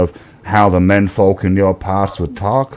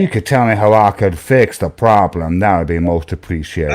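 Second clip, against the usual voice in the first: a lightly put-on Southern gentleman accent, lower; 3 semitones lower than usual. Playing a Southern gentleman calling about his computer, mild accent. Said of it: "generic Southern gentleman accent".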